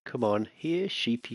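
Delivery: ordinary talk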